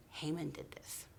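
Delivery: whispered, grave naming of the culprit; hushed accusation. Acted. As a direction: breathe the name like a dangerous secret. Queen accuses Haman.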